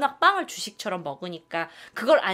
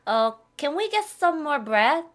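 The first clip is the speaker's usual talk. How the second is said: role-play; 7 semitones higher, 4 dB louder, much slower than usual. Pretend. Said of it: "그 상황에 들어가 보세요".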